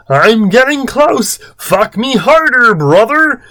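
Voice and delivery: high, hammed-up calling out; swooping mock-passionate urgency; Chris. A narrator's reading. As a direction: cry out in a high put-on voice. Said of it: "Chris said loudly".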